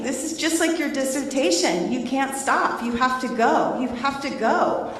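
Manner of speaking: lightly voiced coaching husband; husband urging. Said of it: "he kept saying".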